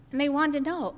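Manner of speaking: ordinary talk, nothing marked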